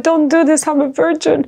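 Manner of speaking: high, pleading cry of terror; retold scream. Captive begging her attackers to stop, high-pitched pleading cry. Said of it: "I screamed".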